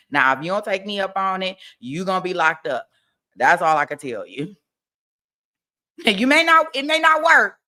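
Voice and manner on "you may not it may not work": high, loud insistence; emphatic. Real, not acted. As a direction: shout the warning. Emphatic advice.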